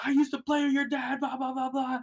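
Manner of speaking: high put-on voice trailing into blah blah; old guy reminiscing. Old player reminisces about playing with his dad, high rambling voice. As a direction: nostalgic old-timer rambling on. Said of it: "And there's like".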